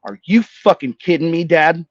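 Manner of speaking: loud, high burst of disbelief; retold indignant outburst; audible outburst. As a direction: blurt it out at Dad, far too loud. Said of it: "audibly, I go".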